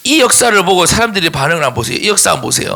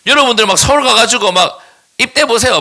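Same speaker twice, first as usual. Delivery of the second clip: ordinary talk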